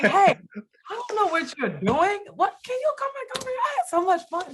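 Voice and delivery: high, rushed, giddy invitation; eager pleading. Acted. Asking him to come back over, high eager pleading.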